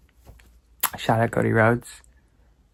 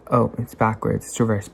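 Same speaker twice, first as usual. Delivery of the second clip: ordinary talk, nothing marked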